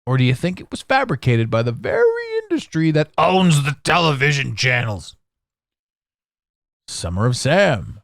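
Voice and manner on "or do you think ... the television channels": ordinary talk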